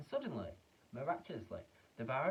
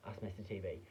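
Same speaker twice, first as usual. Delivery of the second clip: ordinary delivery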